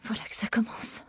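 hushed fearful whisper; murmur. A narrator's reading. Dying Emma to herself as the cold rises, whisper. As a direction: breathe it, barely audible. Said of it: "murmura-t-elle".

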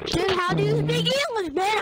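high, loud incredulous question; excited. Real, not acted. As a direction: squeal it in disbelief. Teasing a teammate's speech.

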